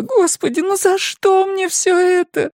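high-pitched anguished plea; crying lament. A narrator's reading. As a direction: wail it in despair. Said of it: "Она заплакала".

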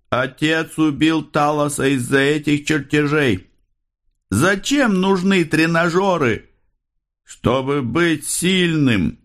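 high-pitched, increasingly drawn-out cries; raving detective. A narrator's reading. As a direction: cry it out high, as if possessed. Raving detective cries out cryptic lines. (высокие протяжные выкрики одержимого)

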